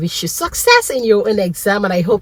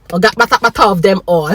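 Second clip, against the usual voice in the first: triumphant laugh; 4 semitones higher, 5 dB louder, faster than usual. Real, not acted. Celebrating the licence.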